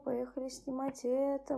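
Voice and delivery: usual speaking voice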